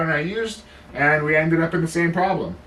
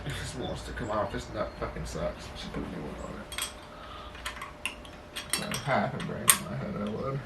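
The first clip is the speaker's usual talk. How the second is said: low, much softer grumbling under the breath at the stuck nut; soft, lower-pitched irritated mumble while working on a stuck nut; frustrated muttering; 5 semitones lower, 14 dB quieter than usual. Real, not acted. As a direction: mutter to yourself, half swallowed, annoyed.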